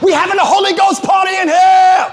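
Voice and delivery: loud, high party shout; high, loud and laughing hype. Retold or imitated. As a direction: whip the church crowd up like a DJ. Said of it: "the D J was like".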